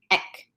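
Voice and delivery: "ek" cut short; brief, tight "ek". Shown as a demonstration. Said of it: "gonna be that short".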